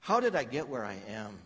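ordinary talk, nothing marked

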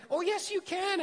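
high, bright cheerleading "oh yes you can"; eager encouragement. Pretend. Preacher mimics a friend cheering someone on, excited and high. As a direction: pump them up like an overeager friend.

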